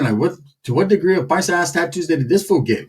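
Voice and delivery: ordinary talk, nothing marked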